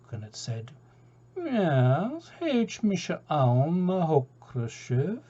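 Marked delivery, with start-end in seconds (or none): 1.4-5.2 slow, drawn-out Gaelic greeting; unhurried, lazy cormorant voice; a laid-back cormorant offering to go; laconic cormorant. Acted. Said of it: "rather a laconic creature".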